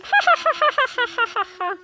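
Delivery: high taunting ha ha; mocking laugh. Cat laughing at Dogman, high taunting laugh. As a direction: laugh at him meanly.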